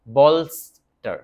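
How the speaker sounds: বলস্টার said slowly and loud, bol-star; careful pronunciation. Shown as a demonstration. Teacher to students, slow and loud: বলস্টার as bol-star. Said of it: "অনেকে আবার এটাকে ব্লস্টার লেখে".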